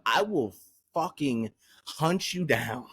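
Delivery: ordinary delivery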